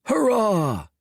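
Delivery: many voices cheering back; crowd cheer. A narrator's reading. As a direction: answer the cheer together. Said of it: "cried everyone else".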